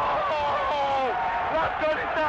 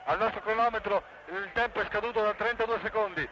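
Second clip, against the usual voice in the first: breathless italian goal commentary; faster than usual. Real, not acted. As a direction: rattle off the action quickly. Live play-by-play continues. (radiocronaca rapida e incalzante)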